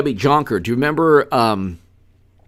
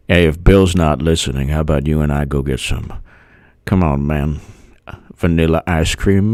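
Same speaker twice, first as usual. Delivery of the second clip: low hammed-up old statesman; mock old politician; 9 semitones lower than usual.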